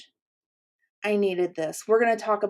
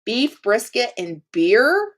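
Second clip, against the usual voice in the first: ordinary talk, nothing marked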